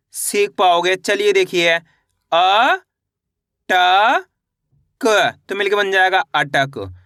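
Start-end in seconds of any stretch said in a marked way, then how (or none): 2.3-5.3 each letter drawn out, with a long pause after it; stretched, separated syllables. Shown as a demonstration. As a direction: sound out the letters one at a time for learners. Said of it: "एक अक्षर का उच्चारण किस तरीके से".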